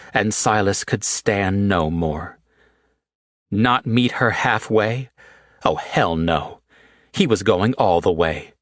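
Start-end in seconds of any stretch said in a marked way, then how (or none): none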